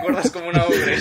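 loud, laughing repeat; laughing out the words. Real, not acted. Player repeats joke.

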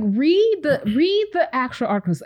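high, loud exasperated outburst; fed-up mock rant at careless sharers; exasperated plea. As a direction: yell it at the people sharing stuff. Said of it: "And I'm like".

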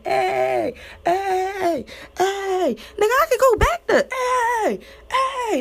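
high, chanted party hey; mock party cheer. Crowd cheering at the party, high chanting. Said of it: "Everybody was screaming".

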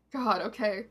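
plain reading voice

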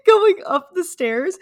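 high, loud, laughing while talking. Real, not acted. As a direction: laugh through the words, near tears. Sister retelling fall, cracking up.

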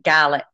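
"Gaelic" said GAL-ik; "Gaelic" rhyming with "phallic"; Scottish pronunciation. Shown as a demonstration. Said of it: "we do pronounce it".

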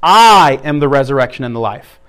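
I hit firmly, the line said low; stress on I. Shown as a demonstration. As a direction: punch I, the rest falling. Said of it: "just emphasize each one".

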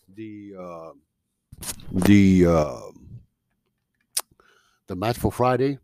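talking between sips of water; words around a mouthful of water; while sipping a drink. Real, not acted. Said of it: "Excuse me, I'm drinking some water".

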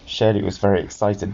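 ordinary delivery

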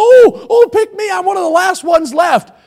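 high, loud, hammed-up begging; mock plea to be chosen; desperate player. One of the last left begging team captains, mock-desperate high pleading. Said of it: "you're getting so discouraged when it's coming down".

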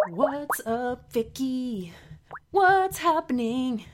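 singsong calling. Pretend. Calling friend on Skype.

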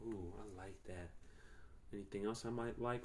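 low, hushed mock seduction; very soft, teasing come-on; flirty imitation. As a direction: drop the voice to mimic a flirty woman. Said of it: "in the same flirty ass way too".

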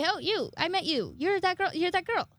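high, excited voice of someone recognizing a face; mock recognition. Singer recognizing the fan outside, high and excited. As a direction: act out sudden delighted recognition.

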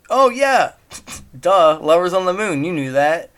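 loud, high sarcastic "duh"; mock know-it-all, starting high and settling; mock obviousness. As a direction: act as if everyone already knows. Mocks assumed common knowledge.

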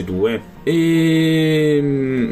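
ordinary talk, nothing marked

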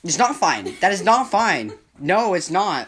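firm, stressed 'not' each time; insistent. Real, not acted. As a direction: push back hard on 'not'. Insisting against partner.

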